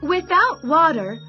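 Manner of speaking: ordinary delivery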